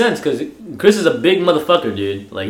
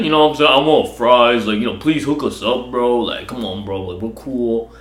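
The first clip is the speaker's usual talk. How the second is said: fake deep teen voice; 4 semitones lower than usual. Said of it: "fake lower your voice".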